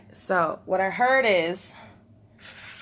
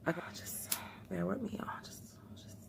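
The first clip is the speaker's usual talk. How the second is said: whisper; 14 dB quieter than usual. Real, not acted. Quiet aside while concentrating.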